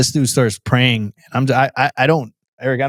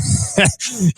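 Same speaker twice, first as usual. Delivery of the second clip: real laugh; 9 semitones higher than usual. Real, not acted. Laughing at own old attitude.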